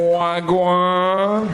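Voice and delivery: loud mock Jamaican accent greeting; drawn-out greeting in a hammed-up Jamaican accent; put-on Jamaican accent. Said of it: "why not put on a Jamaican accent?".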